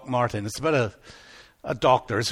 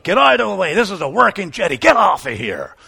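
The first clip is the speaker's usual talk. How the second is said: gruff fisherman yell; 3 semitones higher, 6 dB louder than usual. Retold or imitated. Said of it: "And he comes up".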